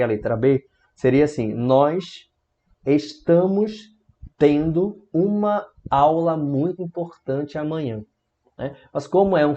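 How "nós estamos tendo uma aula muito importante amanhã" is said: slow, deliberate literal translation; word-for-word translation. Shown as a demonstration. Teacher showing students a literal translation, word by word. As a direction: spell out each word with pauses. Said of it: "traduzir ao pé da letra".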